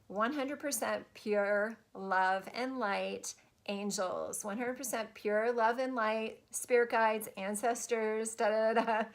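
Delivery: slow, deliberate affirmation, every word weighted; slow demo phrase. Shown as a demonstration. Coach showing viewers the protection phrase, slow and deliberate. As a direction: spell out the phrase slowly for them to copy. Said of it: "Use that phrase in everything you do".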